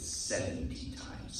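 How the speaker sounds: slow hushed punchline; hushed reveal. Acted. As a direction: drop the voice down to reveal it. Pulling the rug out on Peter.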